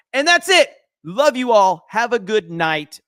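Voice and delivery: loud, high-pitched call-out; emphatic sign-off. Real, not acted. Streamer ending the show for viewers, calling out. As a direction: call it out to wrap up.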